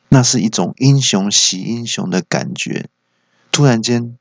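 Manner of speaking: ordinary delivery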